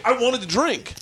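high-pitched comic grievance; exasperated complaint, played for laughs; retold whining protest. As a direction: whine like you've been cheated. Retold quarrel over cut-off drinks.

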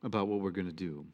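calm invitation. Retold or imitated. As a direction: speak low, patient, like a teacher gathering students. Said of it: "he calls them and says".

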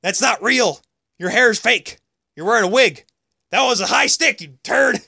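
high taunting heckle at a ref; mock heckling. Fan heckling referee Kerry Fraser about his hair, high mock jeer. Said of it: "and said".

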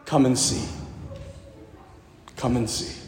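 Jesus gently inviting him closer, quietly; gentle invitation. Retold or imitated. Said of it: "and Jesus said".

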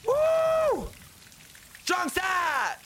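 high, full-voiced war cry; yelling. Runner rallying the group up the mountain, yelling. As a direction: belt it out like a team chant. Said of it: "what are you yelling".

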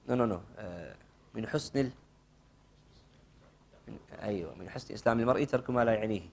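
ordinary delivery

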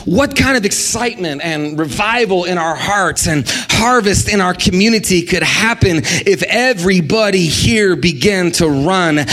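ordinary delivery

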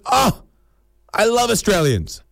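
high, delighted exclamation; gushing enthusiasm. Acted. Romanian shopkeeper finding out the customer is Australian, gushing enthusiasm. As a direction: gush it like a starstruck foreigner. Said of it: "maybe we need more enthusiasm".

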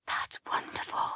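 very soft, breath-only reply; whisper. A narrator's reading. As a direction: whisper back under the singing. Whispered reply during the service.

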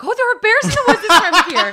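high, loud mock alarm; alarmed warning. Woman at the shrine warns hiker about bears, alarmed. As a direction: cry out the warning in imitated shock.

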